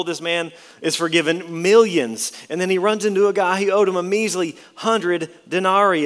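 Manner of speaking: loud, rising pulpit voice driving the point home; full-force preaching. Acted. Preacher pressing the congregation on mercy, full force. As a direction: preach it out to the whole room.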